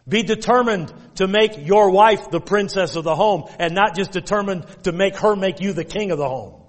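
ordinary talk

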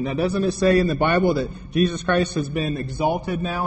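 ordinary talk

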